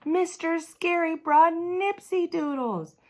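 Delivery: high, loud, slow happy shout; thrilled kid yelling; excited cheer. Acted. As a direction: cheer it to the whole room. Lenny cheers about snack.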